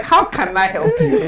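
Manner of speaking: laughing, high replay of a customer greeting; high-pitched repeat. Retold or imitated. Drive-through worker repeating himself to baffled customer, high and laughing.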